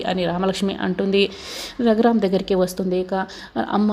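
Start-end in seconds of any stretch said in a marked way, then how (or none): none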